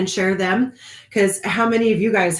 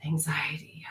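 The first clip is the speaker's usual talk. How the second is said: whispered; 4 semitones lower, 9 dB quieter than usual. Real, not acted. Whispered word.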